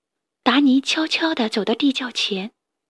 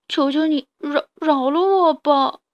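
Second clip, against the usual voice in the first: pitiful begging; 4 semitones higher than usual. Acted. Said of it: "扑哧扑哧地说".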